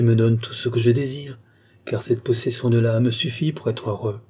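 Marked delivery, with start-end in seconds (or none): none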